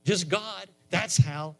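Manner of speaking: high-pitched, impassioned pulpit delivery; fervent preaching. Real, not acted. Preacher tells congregation how you get saved, fervent and high-pitched. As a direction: preach it with rising urgency, pitched well up. Preaches salvation and models prayer.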